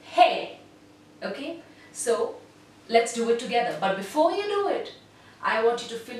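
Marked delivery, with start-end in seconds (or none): 0.0-0.6 firm projected hey; projected call. Shown as a demonstration. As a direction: throw the hey to the far wall. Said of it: "physically throw this sound".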